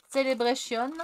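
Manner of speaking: "Celebration" said as French, stress on the last syllable; French accent on purpose, for fun. Shown as a demonstration. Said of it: "mon accent, euh, à la française".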